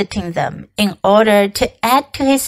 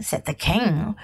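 ordinary delivery

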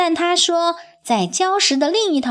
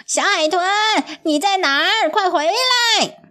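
shouting out; 7 semitones higher than usual. A narrator's reading. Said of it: "呱唧大喊着".

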